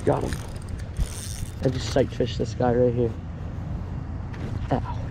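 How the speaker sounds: usual speaking voice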